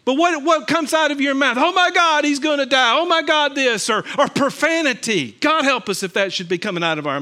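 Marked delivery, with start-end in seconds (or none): 1.6-3.9 mock panic. Mock panic exclamation in sermon.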